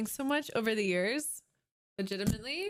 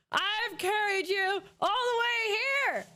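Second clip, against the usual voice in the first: loud rant; 10 semitones higher, 4 dB louder than usual. Real, not acted. Repeats the rant.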